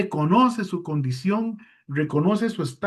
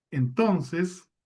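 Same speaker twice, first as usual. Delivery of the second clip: ordinary delivery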